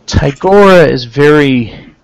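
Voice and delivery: put-on slow, deliberate, lightly Terran-accented councilman. Councilman to visitors about their missing friends, light Terran accent. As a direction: speak slowly with a slight invented Terran accent. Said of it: "he has a strong Terran accent".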